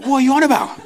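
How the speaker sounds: high, loud incredulous squeak; mock shocked reply. Replying to the churchgoer, high and incredulous. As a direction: play it as baffled and amazed. Retelling confused reply to rumour.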